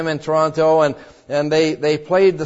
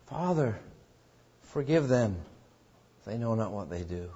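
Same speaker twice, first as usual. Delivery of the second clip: soft, low dying plea; tender prayer; 8 semitones lower, 13 dB quieter than usual. Retold or imitated. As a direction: whisper it from the cross, pleading. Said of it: "the words from the cross".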